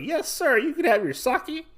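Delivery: very high, obliging voice; squeaky-high mock servant reply; imitated serving girl. Serving girl answering the gambler's order, very high put-on voice.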